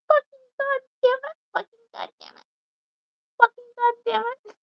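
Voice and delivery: put-on very high baby voice swearing; cursing baby. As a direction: imitate a tiny cursing toddler. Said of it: "talking baby on TikTok".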